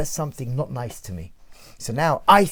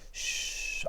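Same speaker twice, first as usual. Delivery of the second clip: soft soothing shush; hushing; 11 dB quieter than usual. Acted. The world of Adam calming the urge to attack, hushing.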